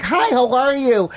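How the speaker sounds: high-pitched mock tourist voice; friendly Arkansas tourist. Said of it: "This woman comes up to me from Arkansas".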